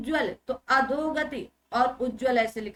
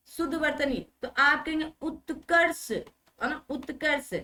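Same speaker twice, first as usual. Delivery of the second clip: ordinary delivery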